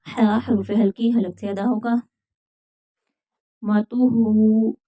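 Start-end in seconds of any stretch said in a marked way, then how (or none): none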